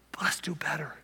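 soft whisper, kind nudge; Jesus urging the woman softly in a whisper; whispered encouragement. Retold or imitated. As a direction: lean in and whisper it. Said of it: "I think he's saying to her".

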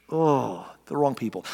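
ordinary talk, nothing marked

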